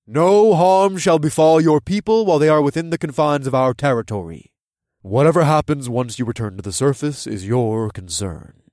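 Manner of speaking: loud, formal ruler's decree; loud proclamation. A narrator's reading. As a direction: announce it so all can hear. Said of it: "said in a loud voice so all could hear".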